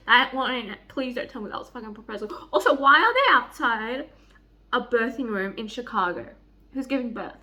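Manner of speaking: ordinary talk, nothing marked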